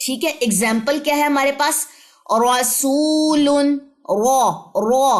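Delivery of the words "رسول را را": رسول with a full heavy ra, then را drawn out slowly; teacher stretching the heavy ra in رسول; heavy tajweed ra. Shown as a demonstration. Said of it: "اقصی لسان پیچھے سے اٹھائیں".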